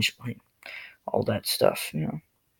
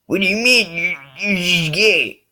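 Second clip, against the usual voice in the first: mock frustrated bully; 7 semitones higher, 4 dB louder than usual. Bully frustrated by calm answers, mock whining. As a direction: whine it high and stretched.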